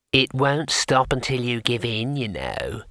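casual little imp voice, slow; small conversational voice. Acted. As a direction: chat it like a tiny amused imp. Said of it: "said a small voice, conversationally".

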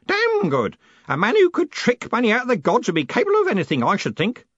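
put-on very high, loud old man's glee; cackling old man. A narrator's reading. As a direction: crow admiringly in a cackling voice. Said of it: "the old man cackled".

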